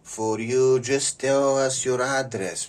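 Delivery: put-on high, loud Italian accent, slow; hammed-up Italian pizzeria caller; Italian pizza man luring a target, high and loud. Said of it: "this is Luigi from the Italian restaurant".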